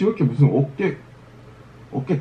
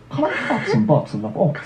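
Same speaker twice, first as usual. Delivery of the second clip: slow, put-on British accent; much slower than usual. Shown as a demonstration. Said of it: "British accent?".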